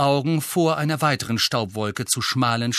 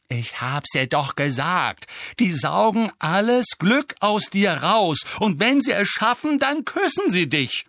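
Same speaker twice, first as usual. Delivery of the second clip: Harry, exasperated; 7 semitones higher, 3 dB louder than usual. Acted. Nephew explains Dementors to uncle, exasperated. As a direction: spell it out, voice rising with exasperation.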